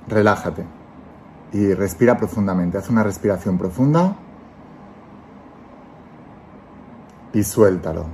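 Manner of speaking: calm guiding voice, let each phrase settle; guided meditation. Acted. Leads a guided visualization.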